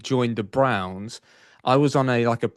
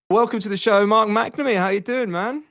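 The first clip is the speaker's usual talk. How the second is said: big excited welcome; 11 semitones higher, 5 dB louder than usual. Acted. Host welcoming guest on air.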